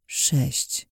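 breathless unvoiced whisper. Dreamer counting six fingers, whispering the number in shock. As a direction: let the realization sink in, under breath. Stunned realization.

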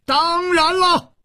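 very high, drawn-out strained yell; defiant screamed retort; roaring. A narrator's reading. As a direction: yell it back at the top of the range, stretching it. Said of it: "李玉涵也大吼着".

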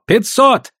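high, forceful bid; shouted bid. A narrator's reading. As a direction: call out a bold stake. Gao raises the stake.

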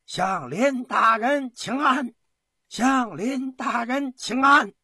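high, loud, drawn-out call; slow parade-ground chant, pitched high; troops' loud salute. A narrator's reading. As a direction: hail the commissioner like massed soldiers. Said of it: "齐声高呼".

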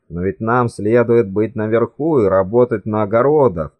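usual speaking voice

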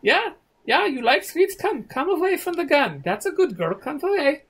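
very high hammed-up cajoling; high-pitched, over-eager mock enticement; coaxing a child. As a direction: coax a little girl as if luring a pet. Men coax girl away from gun.

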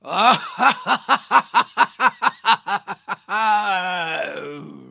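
booming villainous laugh; evil laugh. Acted. Forest spirit laughs at the trapped prince.

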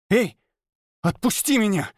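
put-on high, angry girl's voice; voiced girl hissing in anger. A narrator's reading. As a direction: spit it at him, furious. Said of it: "злобно прошипела она".

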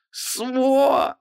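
high, choked, strangled curse; squeezed out. A narrator's reading. Wife held by the throat curses husband, strangled. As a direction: force the word through a crushed throat. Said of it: "выдавила она".

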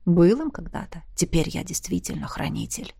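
low soft inner voice; disembodied voice. A narrator's reading. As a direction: murmur from inside her head. Guardian answers.

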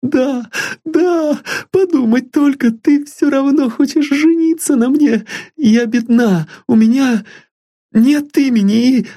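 put-on high, eager young girl pleading sweetly; a lovesick girl dreaming of a home; high girlish voice full of hope; young girl Sylvia. A narrator's reading.

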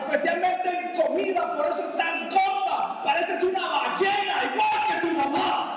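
furious high, loud shouting at his wife; angry husband shouting. Acted. Husband coming home and yelling insults at his wife, furious. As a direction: tear into her like a raging husband. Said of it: "el tipo llega a la casa y dice así".

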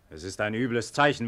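normal talking voice

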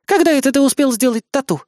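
high-pitched outraged questioning; indignant. A narrator's reading. Idol girl dangling from his arm, indignant. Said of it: "возмутилась девочка-айдол".

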